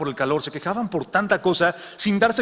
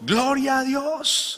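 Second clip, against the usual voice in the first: slow, high cry of praise; a high, drawn-out cry of praise; shouting; 10 semitones higher, much slower than usual. Acted. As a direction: stretch it out high, full of thanks. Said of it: "gritó".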